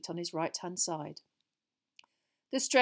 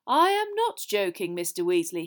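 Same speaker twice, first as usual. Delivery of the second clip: Dumbledore replying good-humouredly; 7 dB louder than usual. Acted. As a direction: answer the heckler from the podium.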